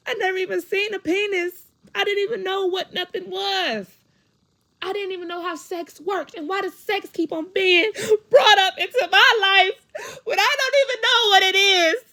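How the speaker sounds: real distressed yelling, very high, gasping for breath; anguished shouting. Real, not acted. Woman recalling sexual shame at ten, anguished shouting. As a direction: cry it out at the top of the voice, building.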